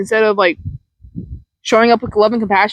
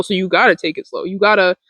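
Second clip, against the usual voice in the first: ordinary delivery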